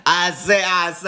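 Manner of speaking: Southern cartoon rooster. Looney Tunes rooster impression.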